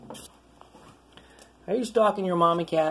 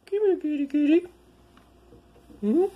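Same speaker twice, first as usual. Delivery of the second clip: high sing-song kitty call; calling the cat; 11 semitones higher than usual. Real, not acted. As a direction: coo it up high to lure the cat.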